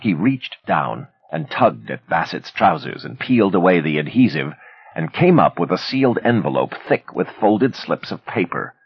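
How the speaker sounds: ordinary delivery, nothing marked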